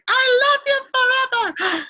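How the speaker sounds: high, loud, slow yell of "love you forever". Shown as a demonstration. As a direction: belt it out like an exclamation mark. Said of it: "that's just like yelling".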